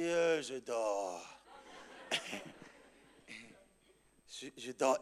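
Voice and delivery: sleepy answer. Retold or imitated. Pastor woken at night answering his friend, drowsy. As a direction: imitate being half asleep on the phone.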